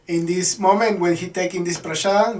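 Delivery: ordinary delivery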